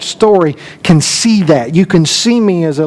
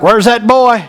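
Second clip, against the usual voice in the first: raised-pitch mock angry demand; retold anger, higher but not shouted; mad granddad; 6 semitones higher than usual. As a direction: imitate an angry granddad hunting for the boy. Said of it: "and he's mad".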